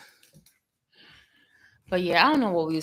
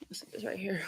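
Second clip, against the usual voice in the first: whispering; 13 dB quieter, faster than usual. Real, not acted. Muttering while setting up.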